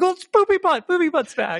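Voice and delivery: high-pitched mock excitement; excited announcement. Re-enacting excitement at recurring character.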